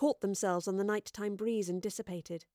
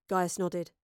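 ordinary delivery, nothing marked

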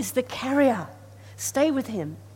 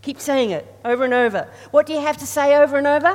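loud, fast call to the congregation; rousing call; 5 semitones higher, 12 dB louder, faster than usual. Real, not acted. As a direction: fire up the crowd to answer.

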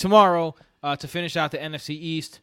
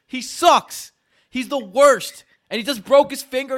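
heated rant; 8 semitones higher, 5 dB louder than usual. Real, not acted. Continuing angry rant.

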